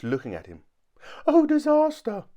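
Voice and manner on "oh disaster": very high, loud wail of alarm; terrified scream. A narrator's reading. Mister Jelly wakes to see the tramp's face, screaming. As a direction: scream it in a squeaky panic. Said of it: "he screamed".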